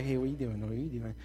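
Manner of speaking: low, soft phone sweet-talk; lovey-dovey late-night phone voice, mock-tender; teen on phone.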